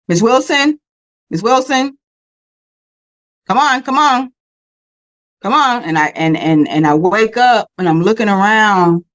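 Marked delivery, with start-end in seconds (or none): 0.1-5.8 paramedic calling her. Retold or imitated.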